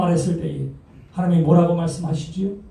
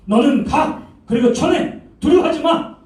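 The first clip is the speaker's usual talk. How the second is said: rising, louder urging; rising command; 8 semitones higher, 3 dB louder than usual. Acted. God sending Jeremiah out, command building higher. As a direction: push him forward with each phrase.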